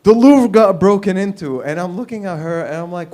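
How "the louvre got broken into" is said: high, loud frantic announcement; frantic wife. Retold or imitated. Wife bursting into the house, frantic. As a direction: burst in with panicked news. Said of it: "my wife ran into the house all frantic".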